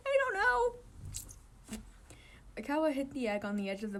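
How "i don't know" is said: laughing. Real, not acted. Reader still laughing.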